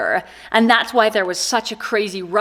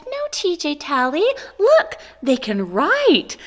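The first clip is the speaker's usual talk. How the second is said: higher, coaxing teacher voice, slowing at the end; consoling teacher; 6 semitones higher than usual. Retold or imitated. Teacher shows crying pupil the pencils, coaxing. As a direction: imitate a teacher reassuring a child.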